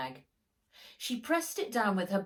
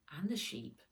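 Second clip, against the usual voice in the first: usual speaking voice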